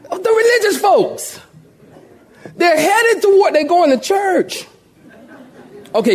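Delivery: high-pitched, incredulous preacher's exclamation; impassioned preaching. Real, not acted. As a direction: drive the irony home to the congregation. Preaches pointedly at full pitch.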